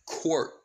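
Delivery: "quirk" set apart and drawn out; slow down and lean on "quirk"; "quirk" said with deliberate emphasis. Shown as a demonstration. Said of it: "I put it more emphasis on the word".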